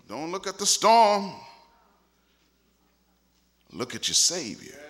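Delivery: usual speaking voice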